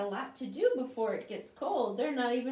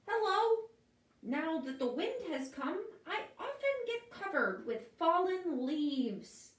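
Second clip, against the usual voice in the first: high little puddle voice; lightly voiced puddle; 6 semitones higher than usual. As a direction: puddle telling about fallen leaves, high. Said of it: "says the puddle".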